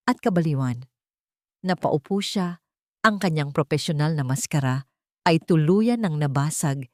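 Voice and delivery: plain reading voice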